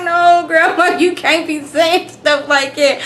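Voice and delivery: high, loud, laughing no; giggling at Grandma's blunt words; laughing protest. Real, not acted. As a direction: scold her through giggles. Reacting to Grandma's remark.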